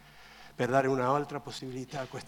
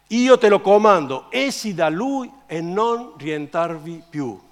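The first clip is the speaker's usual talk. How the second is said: commanding, loud, then slower; emphatic raised-voice command; stern rebuke; 5 semitones higher, 12 dB louder, slower than usual. Acted. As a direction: order it out with deliberate weight. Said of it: "e sgridò allo spirito immondo".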